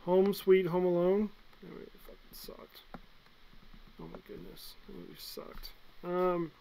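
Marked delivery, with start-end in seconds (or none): 1.6-5.7 breathy whisper, far softer, a bit fast; whispered disgust. Real, not acted. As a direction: hush it like a secret verdict. Whispered rant about bad movie.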